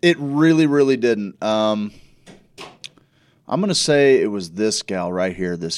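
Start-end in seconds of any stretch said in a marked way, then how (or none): 0.0-1.4 emphatic agreement. Real, not acted.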